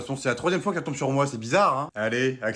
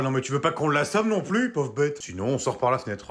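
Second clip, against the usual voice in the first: normal talking voice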